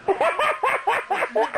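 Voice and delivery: high burst of laughter; real laugh up high. Real, not acted. Laughing at own story.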